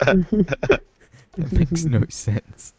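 high, loud, slow disbelief; incredulous. Real, not acted. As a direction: stretch it out in disbelief. Outraged disbelief at friends' joke.

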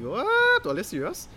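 sudden high-pitched What, then dropping back; mock disbelief at a silly claim; incredulous what. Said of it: "And I'm like".

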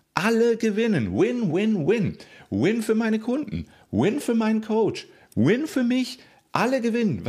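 rally the listener with joy; excited. Real, not acted. Cheering about winning.

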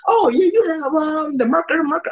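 mock doctor. To the patient off Google, high mocking doctor voice. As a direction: make fun of the doctor's diagnosis.

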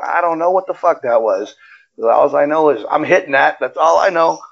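high-pitched retold amazement; astonished bafflement. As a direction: act out being baffled, voice pitched well up. Acts out his own astonished reply.

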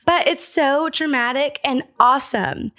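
usual speaking voice

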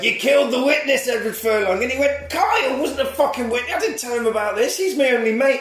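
put-on high, whiny upset teen voice; mock whiny teen. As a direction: whine back at the accuser. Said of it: "And he's like".

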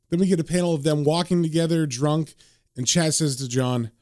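usual speaking voice

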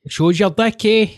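high-pitched mock north-east Scottish accent; mimicked regional accent. Shown as a demonstration. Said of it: "They've got a different one".

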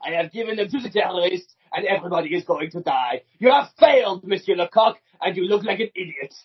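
scolding French agent; hammed-up verdict in a mock French accent; Melanie. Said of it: "Should I be Melanie?".

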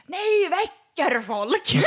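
high, loud, drawn-out mock yell; hammed-up outraged scream; screaming from a balcony. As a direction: holler it slowly at people below. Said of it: "han skriker verkligen från sin balkong".

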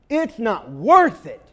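loud, slow, every word hit; loud, slow, drawn-out quitter's line, stressed on worth; stressed mock line. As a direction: land this phrase hard and slow for the crowd. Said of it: "catch this next phrase".

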